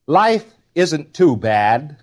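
drawn-out, lightly voiced cheerful papa; a hint of a reassuring father, slowed right down; slow papa line. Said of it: "this is all Papa's dialect".

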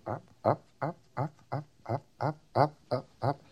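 murmured chant of up, up, up, nearly under the breath; softly mimicking a needle creeping upward; quiet repeated up. Shown as a demonstration. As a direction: count the meter rising softly, almost to yourself. Mimicking the tone arm rising.

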